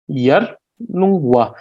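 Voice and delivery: "yarlungwa" slowly split as yar, lung, wa; syllable by syllable. Shown as a demonstration. Teacher breaking a place name down, slow separated "yarlungwa". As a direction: say "yarlungwa" at half speed, pausing between syllables. Word broken into syllables for learners.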